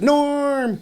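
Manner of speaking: high, drawn-out call; greeting cheer. Retold or imitated. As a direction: imitate a crowd hailing a regular. Room hailing a regular by name.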